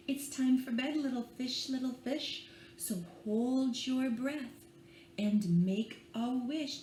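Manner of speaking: put-on gentle read-aloud for small children; bedtime story reading. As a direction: lull them along with the rhyme. Reads picture book aloud.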